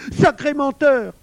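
high, slow, very loud outburst; drawn-out furious cry; near-tearful rage. A narrator's reading. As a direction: shout the insult in helpless fury. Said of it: "qui pleurait presque de rage".

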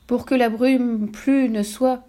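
slow, high, drawn-out incantation; solemn spell-casting recitation; reciting aloud. A narrator's reading. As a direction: intone the spell very slowly. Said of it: "se mit à réciter à haute voix".

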